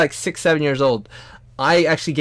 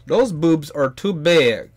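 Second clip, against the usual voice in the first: mock critic voice; 4 semitones higher, 6 dB louder, slower than usual. Imitating a critic.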